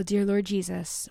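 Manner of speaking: normal talking voice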